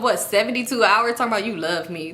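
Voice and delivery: ordinary delivery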